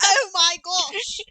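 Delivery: teasing the host with an exaggerated British accent; mock British accent. Cohost mocking the host's slip into a British accent. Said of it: "he's British".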